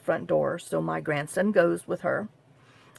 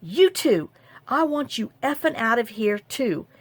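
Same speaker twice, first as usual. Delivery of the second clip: retold yell; 5 semitones higher, 4 dB louder than usual. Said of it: "my sister yelling at her".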